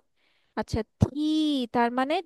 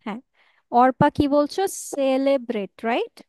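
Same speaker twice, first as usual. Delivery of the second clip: ordinary talk, nothing marked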